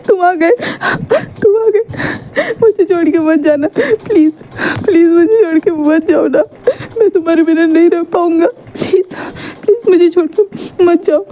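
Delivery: high, tearful begging through sobs; crying plea. Acted. Sick boy begging his love not to leave, sobbing. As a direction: beg him to stay, voice breaking. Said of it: "रो रहा था".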